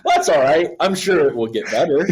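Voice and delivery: high, mock-hopeful self-reassurance; retold forced optimism. Friend retelling the second song's letdown, high and falsely hopeful. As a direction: pitch it up, kidding yourself it is fine.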